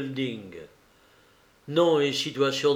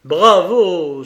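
loud, higher cry of applause; exclaimed bravo; 4 semitones higher, 11 dB louder than usual. Acted. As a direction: call it out loudly. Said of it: "s'écria Bintray".

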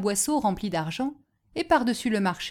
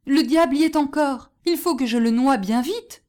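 loud, raised mock alarm; put-on urgent exclamation; feigned fright; 5 semitones higher, 6 dB louder than usual. A narrator's reading. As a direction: pretend to panic aloud. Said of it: "feignant de s'effrayer".

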